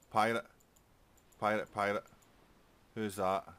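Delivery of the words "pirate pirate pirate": sudden loud high calls of pirate; excited call. Real, not acted. Gamer spots an enemy pirate ship, excited loud call. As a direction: shout it out as you spot the ship.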